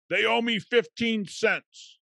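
high, slow mimic of a petty customer; a hammed-up imitation of the rude guy in line; mocking the rude customer. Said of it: "Guy's like".

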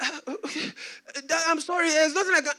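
cowed apology. Retold or imitated. Threatened son apologizing to Dad, nervously faltering. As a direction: make a nervous excuse, acting the son.

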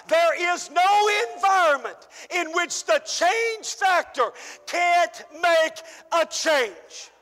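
usual speaking voice

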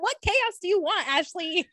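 high-pitched incredulous question; mock exasperation. Co-host questioning friend's wish for chaos, mock exasperation. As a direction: demand an answer in playful disbelief.

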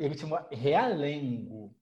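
ordinary talk, nothing marked

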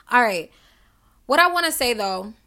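ordinary talk

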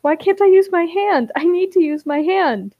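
high, loud, whiny protest; acted distress. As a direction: wail it in mock panic. Acted panic over useless hand.

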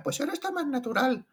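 voz de niño muy aguda, imitada; niño imitado. Retold or imitated. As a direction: imita al niño de doce años con voz chillona aguda. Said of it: "y que el niño dijera". (un niño de doce años opina en el doblaje, voz muy aguda)

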